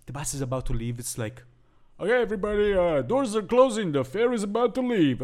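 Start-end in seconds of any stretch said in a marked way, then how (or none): 2.0-5.2 high-pitched ferry conductor announcement; ferry conductor's accent. Acted. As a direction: call out last boarding in a put-on accent. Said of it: "the ferry conductor".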